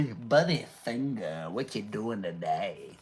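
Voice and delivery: low, slow, soft dummy voice; ventriloquist voice. Pretend. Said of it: "That's my ventriloquist voice coming out".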